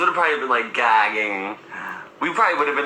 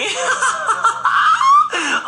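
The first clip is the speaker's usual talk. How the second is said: high laugh; 6 dB louder than usual. Real, not acted. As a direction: burst of shrieking high laughter. Reacting to the old man's remark.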